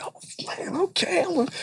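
put-on high-pitched delighted little boy; excited child. Son accepts dad's puzzle deal, excited. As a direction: burst with a kid's thrilled agreement. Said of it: "his son is excited".

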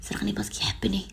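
whispered, dazzled and lovestruck; dreamy whisper. Retold or imitated. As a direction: breathe it like someone blind in love. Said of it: "وانت تخزر هكذا". (همس حالم مسحور بالحب)

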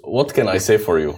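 put-on playful Egyptian-accented English, rising in pitch; mock-exasperated Egyptian accent.

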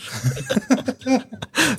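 a short high-pitched laugh at an awkward question; embarrassed high laugh. Real, not acted. Dodging the host's question about his age, high embarrassed laugh.

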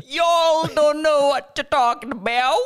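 high, hammed-up Southern twang; Southern accent. Shown as a demonstration. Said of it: "do a Southern accent".

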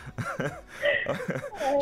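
high laugh. Real, not acted. Host bursts out laughing.

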